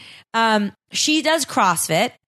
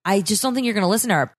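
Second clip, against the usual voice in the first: usual speaking voice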